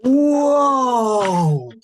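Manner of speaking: long, high whoa of amazement; drawn-out wow-struck exclamation; impressed. Real, not acted. Teacher impressed by counting.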